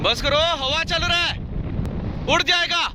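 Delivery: high, strained call over the wind; shouting over wind. Real, not acted. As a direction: call out to him far above. Calling up to friend in wind.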